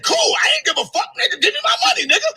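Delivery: high, laughing mock yell demanding the money back; retold yell. Retelling to her viewers what she yelled at Polite, high laughing shout. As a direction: shout it up high, half laughing, like you did back then. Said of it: "So I said".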